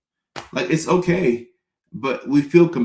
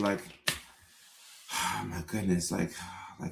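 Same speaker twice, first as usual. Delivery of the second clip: hammed-up sighing, much softer; 5 semitones lower, 12 dB quieter than usual. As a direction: breathe the words out in fake longing. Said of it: "we have to be like".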